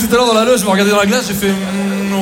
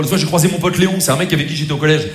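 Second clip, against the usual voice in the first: ordinary talk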